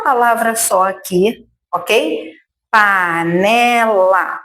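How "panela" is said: "Panela" said slowly, each syllable drawn out; sílabas esticadas. Shown as a demonstration. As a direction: stretch "Panela" into three clear sounds. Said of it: "formada por três sons curtos". ("Panela" devagar, sílaba por sílaba)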